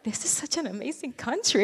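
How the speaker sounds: excited wonder. Retold or imitated. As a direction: gush over the new country. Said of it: "And we were like".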